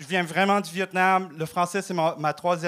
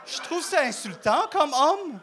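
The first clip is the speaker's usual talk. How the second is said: a higher, hammed-up Québécois accent; mock Québécois accent; 4 semitones higher than usual. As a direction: turn into a pure-laine guy from Lac-Saint-Jean, a touch higher. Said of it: "j'ai perdu mon accent".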